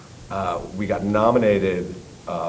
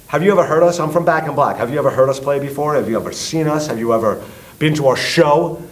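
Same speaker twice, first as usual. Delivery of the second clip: raised, loud demanding questions; angry; 5 semitones higher, 3 dB louder than usual. Retold or imitated. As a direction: grill him hard. Retold angry confrontation.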